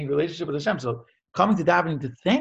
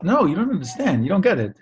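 higher and louder, insisting; earnest protest; 6 semitones higher, 5 dB louder than usual. Retold or imitated. The man answers the concerned onlookers, raised and insistent. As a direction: push back urgently, retold.

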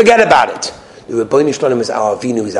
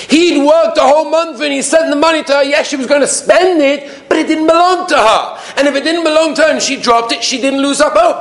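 raised, high-pitched sermon delivery; impassioned preaching. Real, not acted. Rabbi arguing that the money was never hers, impassioned preaching. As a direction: preach it with urgent force.

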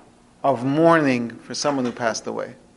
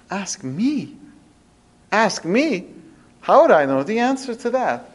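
puzzled disbelief; 4 semitones higher than usual. Retold or imitated. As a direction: act out a humble sage's surprise at being asked. Humble sage disbelieves he was asked.

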